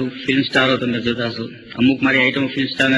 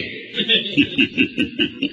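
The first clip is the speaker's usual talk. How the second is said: airy high spooky giggle; hushed eerie laugh in the dark shop; whispered ghost laugh. Retold or imitated. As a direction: breathe out a ghostly titter.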